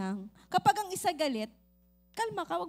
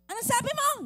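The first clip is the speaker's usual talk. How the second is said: high, loud challenge; raised voice; 10 semitones higher, 4 dB louder than usual. Acted. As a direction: push the question up at the wife. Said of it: "Nilakasan niya".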